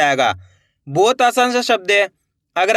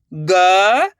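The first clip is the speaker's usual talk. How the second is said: each letter held long, with a pause between; letter-by-letter sounding out. Shown as a demonstration. Teacher showing learners each letter of a word, sounded out slowly. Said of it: "एक एक अक्षर का उच्चारण करके".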